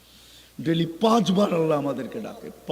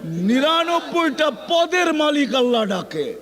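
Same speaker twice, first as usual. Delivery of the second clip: high, loud, slow drawn-out preaching cry; preaching at full force; 10 semitones higher, 7 dB louder, slower than usual. Acted. Preacher crying out the climax to the crowd, high and slow. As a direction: stretch each word out and cry it to the hall.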